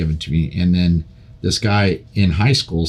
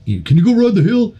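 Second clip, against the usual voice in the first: higher, eager dad asking a favour; father showing off his son to friends; voicing his dad; 11 semitones higher than usual. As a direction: pitch it up like a proud dad. Dad voiced asking him to ride.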